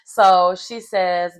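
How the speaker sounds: normal talking voice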